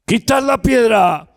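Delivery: loud, high command "Quitad la piedra"; commanding shout. Acted. Jesus ordering the stone moved, high commanding shout. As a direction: order the stone removed with force. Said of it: "Jesús la dijo".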